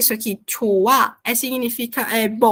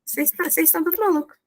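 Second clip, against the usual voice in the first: mock denial; faster than usual. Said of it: "vocês pegam e falam".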